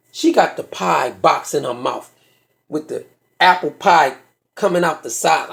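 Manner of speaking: ordinary talk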